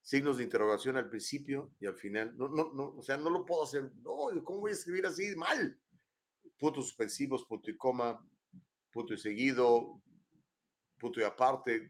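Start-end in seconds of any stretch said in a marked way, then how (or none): 4.0-5.8 protesta aguda, sin alzar el volumen; escandalízate en broma con la voz aguda; indignación fingida. Acted. Rechaza escribir sin signos. (indignado en broma, con tono agudo)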